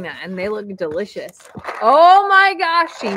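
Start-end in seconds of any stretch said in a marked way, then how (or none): 1.8-3.0 drawn-out, high, loud gasp of delight; real excited outburst; delighted surprise. Real, not acted. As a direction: squeal it slowly, thrilled by the gift. Delighted at finding candy corn gift.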